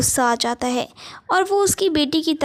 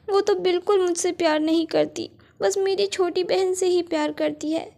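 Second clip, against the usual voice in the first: put-on a hungry child alone at night, pouring out her sorrow; sad girl; 5 semitones higher than usual. Hungry girl alone under tree. (अकेली भूखी बच्ची की ऊँची, उदास आवाज़)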